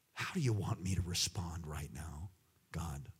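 hushed unvoiced whisper to God; soft whispered plea; whispered prayer. Shown as a demonstration. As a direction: breathe it as a quiet prayer. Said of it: "whispered prayer after loud version".

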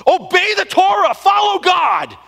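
high, loud acted tirade of a raging zealot; enraged shouting. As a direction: bellow it like a wild man grabbing people. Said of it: "He grabs them and starts shouting".

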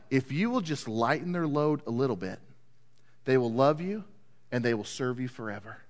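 low, soft elders' advice; quiet, measured voice of advisers; gentle counsel. Acted. Elders give the king gentle advice.

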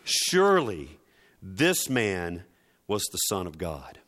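ordinary talk

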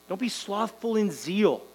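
ordinary delivery

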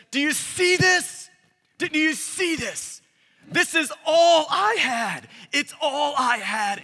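high, loud, slow triumphant taunt; triumphant Jesus. Retold or imitated. Jesus to Satan, a slow triumphant taunt. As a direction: shake it in Satan's face, gloating. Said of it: "in a voice probably three octaves deeper".

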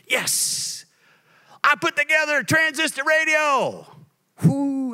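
put-on excited shout of a boy who got it working; boyish triumph. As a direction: cry out yes like a kid who just did it. Boyhood triumph retold.

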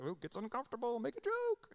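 high, rising cartoonish squeal; mock nervous deflection. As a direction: mimic awkward joking, sliding up into falsetto. Mimicking men deflecting with jokes.

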